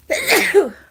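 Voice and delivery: big loud sneeze; sneezing. Real, not acted. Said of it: "I'm getting ready to sneeze".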